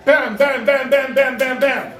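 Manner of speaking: rapid loud volley of bangs; frantic fist on a door; imitated door banging.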